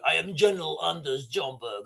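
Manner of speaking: hesitant attempt at a Norwegian general's accent; failed Norwegian accent. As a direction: try a Norwegian accent, badly. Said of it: "sorry, that's not the Norwegian accent".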